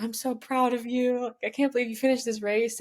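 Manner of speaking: a hint of mom choked up with pride; imitating tearful mom. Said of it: "my mom's in tears, and she's like".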